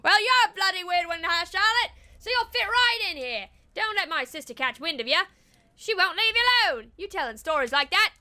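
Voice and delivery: cheeky Australian hunter; Australian accent. Acted. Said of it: "I went Australian".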